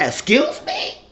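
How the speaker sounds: very high, incredulous comeback; acted affront. Woman answering an accusing man, affronted. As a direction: throw it back in high-pitched offence.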